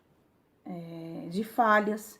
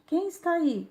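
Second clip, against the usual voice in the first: mild narrated call; 8 semitones higher than usual. A narrator's reading. Curious boy calls to the mountain, mild shout. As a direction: lightly call it out, much higher. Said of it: "o menino grita novamente".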